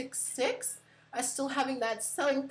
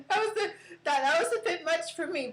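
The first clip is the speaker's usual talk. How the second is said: laughing through the words, pitch up; cracking up mid-sentence; 7 semitones higher, faster than usual. Real, not acted. Laughing at the Aussie phrase.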